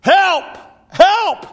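loud, high-pitched yell for help; short, urgent acted shouts; crying out. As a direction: cry out as if drowning. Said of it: "He cried out".

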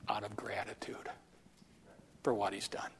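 hushed, low close to a sermon point; hushed emphasis. Real, not acted. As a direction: drop low and very soft. Preacher lowers voice on point.

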